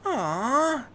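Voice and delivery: put-on high disappointed kid's whine; high mock-whiny aw; complaining boy. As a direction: groan it like a let-down child. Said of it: "Terry complained".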